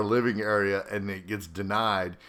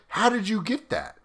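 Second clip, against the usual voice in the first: a high, incredulous demand from the commander; mock disbelief, pitched way up; 12 semitones higher than usual. Commander grilling a junior soldier, high and incredulous.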